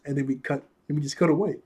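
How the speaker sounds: ordinary delivery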